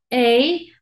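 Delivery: loud, held "ey"; long A sound. Shown as a demonstration. Teacher contrasting two sounds, long "ey". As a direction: hold "ey" out firmly and loud. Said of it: "Un sonido largo".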